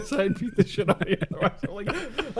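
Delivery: laughing through the words, a bit fast. Real, not acted. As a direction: blurt it out mid-laugh.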